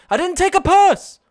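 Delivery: loud, high-pitched outburst of denial; exasperated shout. Real, not acted. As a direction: shout it down indignantly, pitch leaping. Snaps back at chat's purse teasing.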